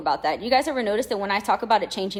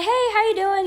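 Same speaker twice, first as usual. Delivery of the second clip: bright greeting; 12 semitones higher, 5 dB louder than usual. Retold or imitated. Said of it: "I was like".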